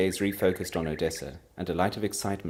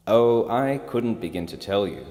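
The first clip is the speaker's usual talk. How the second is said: proudly; 3 semitones higher, 8 dB louder, slower than usual. Acted. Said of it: "Piero replied proudly".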